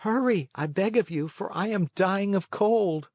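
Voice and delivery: put-on cold boy urging haste; puppet boy begging. A narrator's reading. Pinocchio begging.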